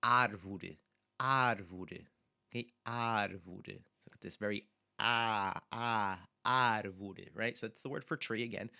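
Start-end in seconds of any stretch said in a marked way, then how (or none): 0.0-2.0 árvore with a wide open first A; árvore, opening long on AH. Shown as a demonstration. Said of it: "you'll hear this open A sound at the beginning". 5.0-7.3 long, wide "ah", then árvore; drawn-out open A starting árvore. Shown as a demonstration. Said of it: "nice and open sound".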